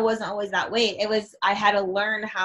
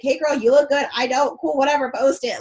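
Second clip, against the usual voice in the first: put-on higher, louder, casual hype-girl voice; breezy girlfriend; 5 semitones higher, 5 dB louder than usual. Girl hyping up a friend's photo, higher and louder.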